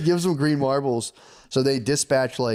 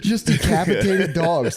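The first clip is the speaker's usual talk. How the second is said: laughing; 5 semitones higher, slower than usual. Real, not acted. Laughing through gruesome detail.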